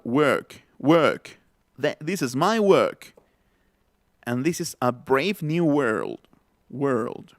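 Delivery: slow, careful British English demo; British vowels, R pulled back to the throat; British accent. Shown as a demonstration. Teacher shows how to say work, British accent. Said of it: "para decir la palabra trabajo".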